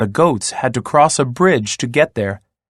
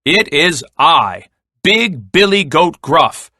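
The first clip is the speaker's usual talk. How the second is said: big, forceful goat voice; booming voice; 5 semitones higher, 4 dB louder than usual. A narrator's reading. Biggest goat answering the troll, booming voice. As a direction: boom it out, word by word. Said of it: "in a booming voice".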